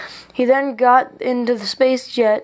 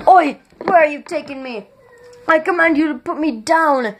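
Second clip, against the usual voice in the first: indignant king yelling; 4 semitones higher than usual. A narrator's reading. King lifted off the ground by Tenebris, yelling indignantly. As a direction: demand to be put down, offended. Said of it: "King Puramalum yelled".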